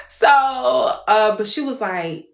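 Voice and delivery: usual speaking voice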